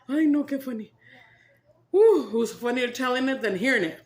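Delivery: loud and giddy; laughing. Real, not acted. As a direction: still giggling loudly. After laughing fit.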